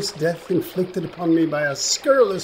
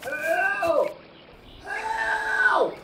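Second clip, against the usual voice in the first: calling for help; 5 dB quieter than usual. Acted. Fish cries for help.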